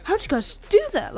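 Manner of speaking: high-pitched mock amazement; awed praise. Timid friend praising his buddies, high awestruck voice. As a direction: marvel at them like they're geniuses. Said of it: "praising us like we're, like, geniuses".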